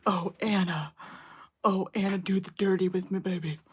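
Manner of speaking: a hint of a sultry leading man, teasing. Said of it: "wants me to read Fifty Shades".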